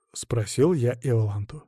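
plain reading voice

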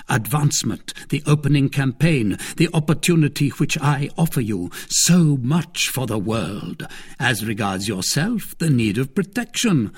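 a hint of a lofty cardinal rebuking; cardinal, reproving. A narrator's reading.

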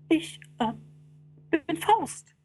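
high, faltering boast, then hurried; stammer, then try to impress the fiend; nervous, high-pitched bragging; bluffing Faust. Acted. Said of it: "dem Bösen etwas Ehrfurcht einzuflößen".

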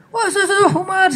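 high-pitched, stammered imitated insult; rude mock cursing. Egyptian passengers insulting each other, mock cursing. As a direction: splutter a hammed-up curse. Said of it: "ngomongnya kasar".

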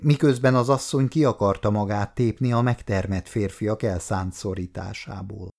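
ordinary delivery, nothing marked

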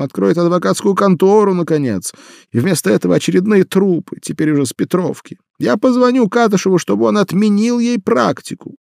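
high, loud and rising in anger; building from upset to outraged; agitated mother. Acted. Mother arguing, escalating.

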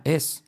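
"es" as at the start of España; short open 'es' for English is; e vowel. Shown as a demonstration. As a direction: clear Spanish E then s. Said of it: "Como España".

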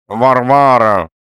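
high, loud, drawn-out call through the nose; wailing husband calling his wife; nasal call. A narrator's reading. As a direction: whine her name out nasally. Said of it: "сказал Лоханкин в нос".

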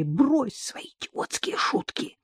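slow, high whispered scolding; angry whisper. Acted. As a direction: hiss it urgently down the phone. Dasha hisses angrily on phone.

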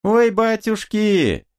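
high, drawn-out wail of alarm; a woman crying for help; woman's cry. A narrator's reading. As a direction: cry out high, stretching the words. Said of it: "За ларями женский крик".

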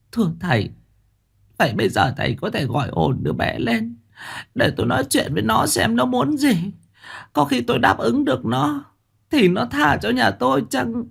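high, strained old woman's voice, light mock crying; bà cụ khóc tu tu. A narrator's reading. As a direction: plead as if sobbing, lightly. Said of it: "Bà Tháo khóc tu tu". (giọng bà lão cao, khóc nhẹ)

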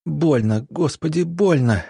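high, plaintive moaning; pained moan. A narrator's reading. Woman in labour pleading to God, pained moan. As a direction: moan it as if in pain. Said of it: "простонала Марина".

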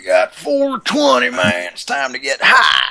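high, loud stoner hippie. Pretend.

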